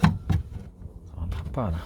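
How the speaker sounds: slow, breathy whisper; whispered frustration. Real, not acted. Part still won't fit.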